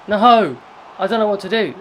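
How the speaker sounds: loud high cry of No!; real shout of despair. Real, not acted. Manager watching his striker miss, real shout. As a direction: yell it at the miss. Striker misses a sure chance.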